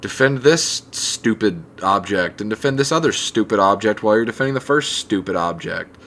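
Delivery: ordinary delivery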